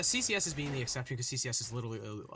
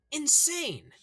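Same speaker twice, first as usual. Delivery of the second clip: excited; 13 semitones higher, 7 dB louder than usual. Real, not acted. Hyping a player's performance.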